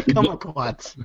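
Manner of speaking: "kumquat" stretched into three syllables, "kum-a-quat"; mimicked three syllables. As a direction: mimic the accent with an extra "a" inside "kumquat". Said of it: "did that have three syllables".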